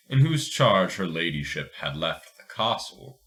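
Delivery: usual speaking voice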